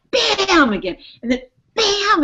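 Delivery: loud, high imitated bang of hail hitting the truck; mock bam. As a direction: punch out each bam like a hit.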